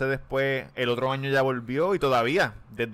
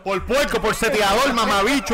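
loud, high excited shout; yelling; 7 semitones higher, 5 dB louder than usual. Real, not acted. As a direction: yell it out, laughing at the pick. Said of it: "Perdonen que grite".

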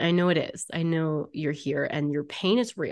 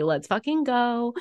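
eager hype; 5 semitones higher, 6 dB louder than usual. Acted. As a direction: hype yourself up, louder by the end. Acts out eager soul voice.

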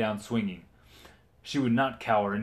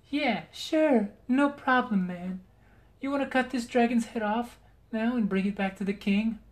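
Margaret voice; 12 semitones higher than usual. Acted. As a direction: toss it off like a buddy. Margaret casual reply.

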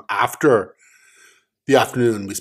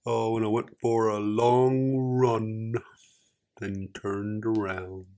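put-on fading Forrest Gump accent; 6 dB quieter than usual. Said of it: "No, you've lost it".

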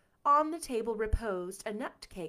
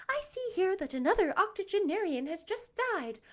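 higher gossiping wife voice; Mrs Sloane; 9 semitones higher than usual. Acted. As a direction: read it as a prim wife.

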